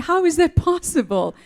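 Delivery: high, loud amazed question; exasperated disbelief. Retold or imitated. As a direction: throw up your hands in disbelief. Retelling shock at no water.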